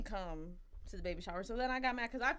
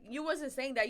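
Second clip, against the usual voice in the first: ordinary talk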